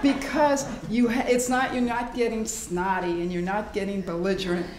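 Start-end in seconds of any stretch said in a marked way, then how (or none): none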